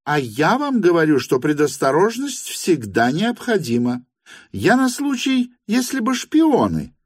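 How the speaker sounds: very high, argumentative hostess voice; insistent hostess. A narrator's reading. Hostess argues for precaution, high and insistent. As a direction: argue back in a high character voice.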